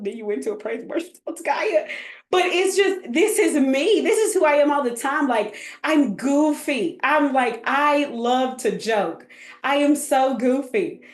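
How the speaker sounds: ordinary talk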